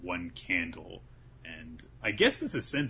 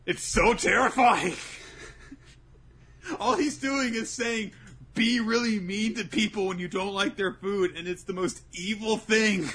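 amused horror; 14 semitones higher, 6 dB louder than usual. Real, not acted. Laughing about scary scene.

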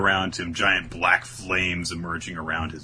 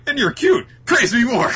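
much higher and louder, fluttery flirting; playful mock flirt; hammed-up squeaky flirt; 7 dB louder than usual. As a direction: fish for compliments shamelessly. Voicing Finral flirting.

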